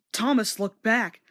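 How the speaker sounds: ordinary delivery, nothing marked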